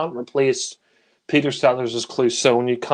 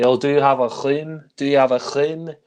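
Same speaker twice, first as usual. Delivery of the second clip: put-on a light, playful French accent with a Clouseau twist; Sellers' Clouseau French accent. Reviewer quoting Sellers as Clouseau, playful French accent. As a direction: chin comes out like a mock French "clean". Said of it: "Peter Sellers as Clouseau would say".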